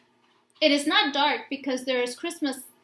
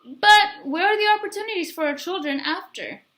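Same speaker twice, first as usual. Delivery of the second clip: higher, louder, searching neighbor's voice; a neighbor pressing the father about opportunities; pointed question; 6 semitones higher, 5 dB louder than usual. Acted.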